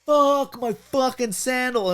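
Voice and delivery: high, slow wail of exasperation; high mock yell, drawn out; retold yell. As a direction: re-enact yelling it, stretched out and high. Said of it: "and I just yell".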